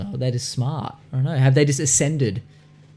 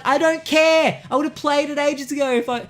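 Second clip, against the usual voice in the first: much higher mock whiny voice; exasperated retort; 11 semitones higher than usual. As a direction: fake whining, fed up with the ad. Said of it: "I'm like".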